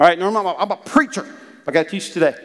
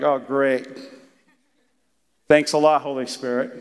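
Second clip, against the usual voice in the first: sarcastic thanks; 6 semitones lower than usual. Acted. Sarcastic thanks to Holy Spirit.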